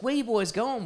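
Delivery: keen question. Retold or imitated. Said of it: "He's like".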